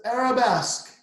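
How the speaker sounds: high, loud arabesque called out; teacher's command. Acted. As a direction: call arabesque like a dance teacher. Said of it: "she says".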